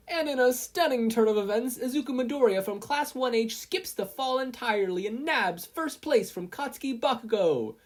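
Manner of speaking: high, loud sportscaster call; announcer yell. A narrator's reading. Present Mike announces the race over speakers, loud announcer yell. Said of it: "Present Mike yelled".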